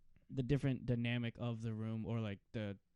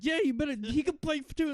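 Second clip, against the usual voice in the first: high, loud mom voice; boastful mom; 13 semitones higher, 6 dB louder than usual. Retold or imitated. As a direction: imitate a mother showing off, pitched way up.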